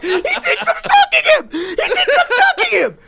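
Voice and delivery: very high, laughing, hammed-up wail; panicked shriek. Man mistaken for a stalker, shrieking in panic. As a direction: squeal it in mock horror. Retold panic at being misunderstood.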